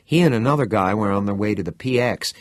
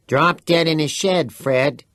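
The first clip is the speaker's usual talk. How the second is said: smart retort; 7 semitones higher, 5 dB louder, slower than usual. Acted. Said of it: "Teddy returned smartly".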